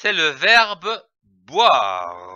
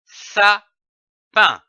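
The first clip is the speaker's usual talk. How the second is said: SAPIN broken into two beats, with a clear pause between; split into syllables; 3 semitones higher, 5 dB louder than usual. Shown as a demonstration. Teacher joining two syllables for learners, split into syllables. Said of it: "L'association de ces deux syllabes".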